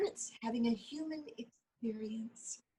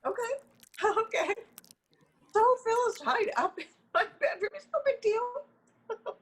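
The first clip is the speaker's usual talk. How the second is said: higher, louder giggling while owning up; sheepish giggly confession of a kidnapping; laughing confession; 11 semitones higher, 5 dB louder than usual. Acted. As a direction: laugh your way through the guilty admission. Guilty laughing confession about Phil.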